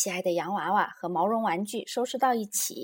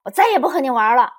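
fast, loud, higher outburst; angry shouting; 5 semitones higher, 12 dB louder, faster than usual. A narrator's reading. Little Bear quits the game, shouting angrily. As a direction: yell it in a huff. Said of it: "小熊妹妹也大声喊着".

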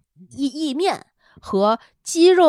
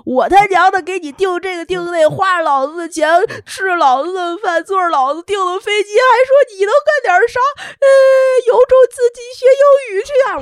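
loud, much higher angry rant; furious rant; 10 semitones higher, 9 dB louder than usual. Retold or imitated. Wife ranting in her head at her husband, furious. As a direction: blow up at him, louder and louder. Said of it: "我想说".

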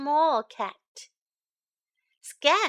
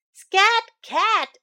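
high, loud honking shoo; a goose chasing the cat; raised and loud "Scat Cat"; 9 semitones higher, 9 dB louder than usual. Acted.

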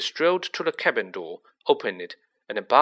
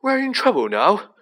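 put-on higher, loud anxious boy; rattled, raised young voice; hoarse; 10 semitones higher, 7 dB louder than usual. A narrator's reading. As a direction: blurt it hoarsely in alarm. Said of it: "he said hoarsely".

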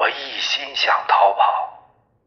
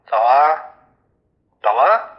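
louder, pressing him to walk away; commander's loud command; 3 semitones higher, 4 dB louder than usual. Acted. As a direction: push him off with a shout. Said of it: "连长又说". (连长厉声催促)

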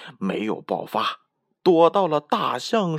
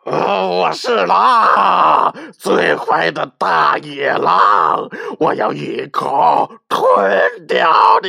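big bad wolf roaring, louder and slow; roaring shout; 4 semitones higher, 7 dB louder, slower than usual. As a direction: bellow the threat with all your might. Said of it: "大声喊道".

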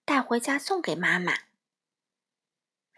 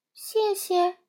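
put-on little girl; 8 semitones higher than usual. A narrator's reading. Peipei guesses, child voice.

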